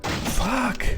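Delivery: sudden high yelp of a swear at a jump scare; jolted, the word jumps up high; startled curse. Real, not acted. Player jolted by a jump scare in the game, startled high yelp.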